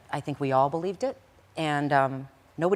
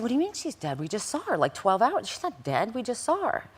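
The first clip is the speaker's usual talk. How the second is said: shocked disbelief; 8 semitones higher than usual. Retold or imitated. Hearing friend is dead.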